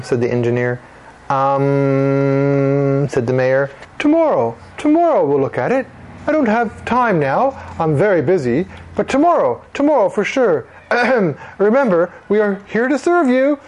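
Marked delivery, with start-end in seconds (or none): 1.3-3.1 long, level, drawn-out filler; hesitant stalling. Acted. Mayor stalls before answering. 4.0-13.6 higher-pitched, busy mayor's brush-off; self-important mayor making promises; evasive politician. Acted. Mayor fobs off the children.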